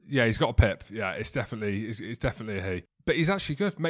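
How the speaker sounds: usual speaking voice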